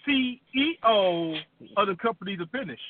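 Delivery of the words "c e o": "C E O" slow, with "E" stressed more than "O"; slow, spelled "C E O" with a big "E". Shown as a demonstration. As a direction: spell out "C E O", leaning hardest on "E". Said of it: "emphasis on the word E is bigger than O".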